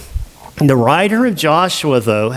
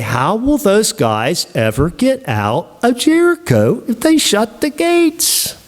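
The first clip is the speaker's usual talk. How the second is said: mock worry; 7 semitones higher than usual. Anxious reader voiced.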